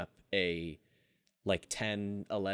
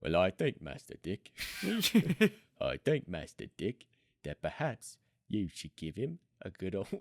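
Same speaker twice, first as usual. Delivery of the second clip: Michael Caine impression. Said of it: "Can you do a Michael Caine?".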